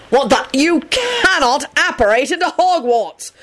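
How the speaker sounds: high shocked bellow. A narrator's reading. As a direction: bellow the rule at an intruder. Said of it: "Kingsley bellowed".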